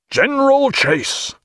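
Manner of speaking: high, slow, drawn-out hail across the deck; called order. Acted. Jack hails Mister Lee to order the chase, high drawn-out call. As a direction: sing out the order so the fleet hears.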